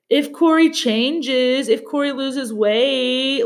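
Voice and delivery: imitated hedging, slow and drawn-out; non-committal fiancée. Voicing non-committal fiancée on TV show.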